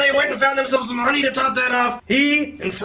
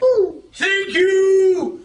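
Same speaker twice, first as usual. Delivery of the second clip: high, drawn-out hooty voice; hooting owl; 7 semitones higher, 4 dB louder, much slower than usual. Acted. Owl answers back from the stump, hooting. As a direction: stretch the words into long hoots. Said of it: "Owl answered back".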